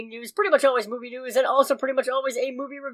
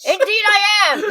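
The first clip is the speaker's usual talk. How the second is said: put-on old-timey pitchman; 10 semitones higher, 5 dB louder than usual.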